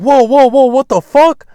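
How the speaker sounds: high, loud panicked outburst; acted startled alarm. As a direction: act jolted awake and alarmed. Retold waking in alarm to red flashlight.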